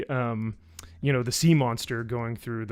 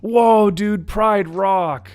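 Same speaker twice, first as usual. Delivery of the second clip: high, loud put-on amazement; mock amazed dude; 8 semitones higher, 9 dB louder, much slower than usual. Dude seeing Pride Rock, loudly mock amazed. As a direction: imitate an awestruck dude from a clip. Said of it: "the dude who's like".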